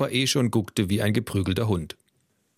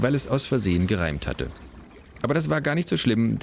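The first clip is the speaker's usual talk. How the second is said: ordinary talk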